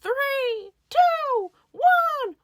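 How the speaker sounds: high, shouted countdown, each number held apart; imitated launch countdown. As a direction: count down like a coaster about to blast off. Ride launch countdown acted.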